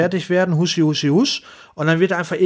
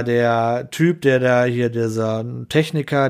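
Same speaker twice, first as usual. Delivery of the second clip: ordinary talk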